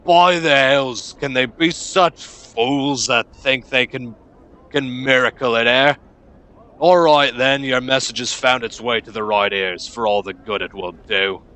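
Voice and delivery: loud, drawn-out oath, then grudging acceptance; exasperated gruff foreman. Acted. Foreman receives an impossible shipping demand, gruff and exasperated. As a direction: grumble at fools' impossible demands.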